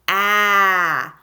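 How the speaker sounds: long, loud "A" as in cat; short A sound. Shown as a demonstration. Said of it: "I'll say the sound".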